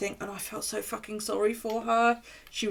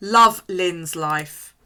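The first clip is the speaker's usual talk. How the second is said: Love Lynn's Life, slow and loud, each word stressed; spelled out slowly; 11 dB louder, much slower than usual. Shown as a demonstration. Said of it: "That's L O V L Y N N S".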